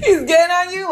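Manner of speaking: high laughing disbelief; laughing mock outrage. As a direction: laugh while quoting her shock. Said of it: "so you were like".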